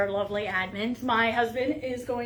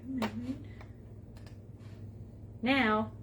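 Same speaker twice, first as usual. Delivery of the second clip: ordinary talk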